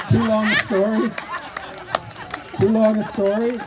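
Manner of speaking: loud, high incredulous echo; mock indignation. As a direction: throw it back loudly, playing affronted. Host mock-indignant at contestant's answer.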